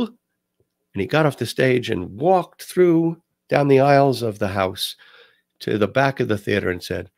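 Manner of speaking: ordinary talk, nothing marked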